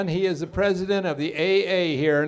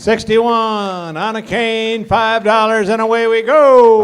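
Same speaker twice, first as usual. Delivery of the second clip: loud, high auction opening call; calling out the lot; 6 semitones higher, 9 dB louder, slower than usual. Real, not acted. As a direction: call it out high, building to a big "go". Auction caller opens the bidding.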